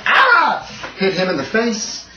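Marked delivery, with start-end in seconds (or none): 0.0-0.9 short high-pitched acted scream. Game master voices someone hit by an arrow, screaming. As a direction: cry out as if struck. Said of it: "there's a snap and a scream".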